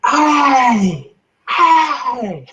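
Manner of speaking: high, loud yelled "Ay"; two piercing, high cries of rage; demonstrated furious scream. Shown as a demonstration. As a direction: scream in mock fury. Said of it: "It's like to be like this".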